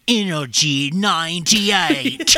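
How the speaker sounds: slow, drawn-out mock radio announcer; mocking the repeated station call; radio jingle imitation. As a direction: stretch it out like a cheesy station ident.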